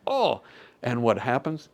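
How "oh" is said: high, louder startled "Oh"; a stunned gasp of a word; dazed. Retold or imitated. Crash victim after whiplash, startled and dazed. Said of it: "and you're like".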